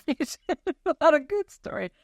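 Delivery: laughing through the words, slow. Real, not acted. Host thanking her guest on the couch, laughing. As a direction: warm laugh breaking into the thanks.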